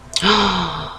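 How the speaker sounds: high, startled intake of breath; real gasp of realization; sudden gasp. Real, not acted. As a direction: gasp as you suddenly remember. Suddenly remembers a forgotten task.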